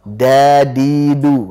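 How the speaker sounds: Dadidu said slowly with the tongue too high on the palate; drawn-out Dadidu with the d touching too far back; wrong dal demo. Shown as a demonstration. Teacher demonstrating the wrong tongue place for dal. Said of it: "Jangan sampai ujung lidah kenanya kepada langit-langit atas".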